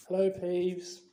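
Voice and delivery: a cautious greeting in a shifted voice. Acted. Harry greeting the poltergeist warily, cautious. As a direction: greet him warily, a touch apart from your own voice. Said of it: "said Harry cautiously".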